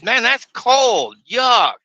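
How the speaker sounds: loud, high and slow, disgusted; mad complaint. Retold or imitated. Trucker complaining to the counter guy: loud, high, slow disgust. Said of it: "I was mad".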